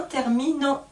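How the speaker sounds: ordinary talk, nothing marked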